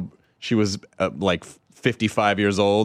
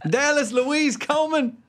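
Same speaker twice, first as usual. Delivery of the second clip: put-on Southern accent. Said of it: "The Southern accent seems to be".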